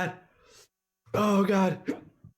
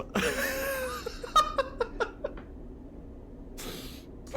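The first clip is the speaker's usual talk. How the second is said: wheezing laughter; 6 dB quieter than usual. Real, not acted. Streamer keeps laughing.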